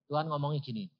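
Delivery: ordinary talk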